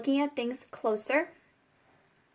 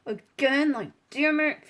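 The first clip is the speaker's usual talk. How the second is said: loud mock frustration; frustrated; 5 dB louder than usual. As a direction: act out fed-up grumbling at full volume. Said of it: "like, so frustrated".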